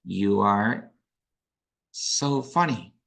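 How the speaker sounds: dry, slow "so funny" with a falling end; deadpan "so funny", heavy with irony; sarcastic. Shown as a demonstration. Teacher demonstrating sarcasm to the class, drawn out. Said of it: "Do you think that this is a sarcasm".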